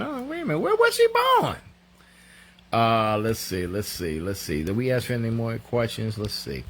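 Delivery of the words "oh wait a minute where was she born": very high, rising to loud; mock bafflement. Host re-enacting confusion over Elnora's birthplace, high baffled cry. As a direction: squeal the question in exaggerated confusion.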